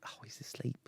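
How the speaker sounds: whispered, to avoid waking Sam; hushed whisper. Retold or imitated. Host whispers to the girls so Sam sleeps on, hushed. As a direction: breathe it softly so he stays asleep. Friend asleep beside him.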